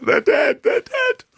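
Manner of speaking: very high, hammed-up frantic wail; mock panic. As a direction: imitate a friend panicking. Worried mate fearing they died.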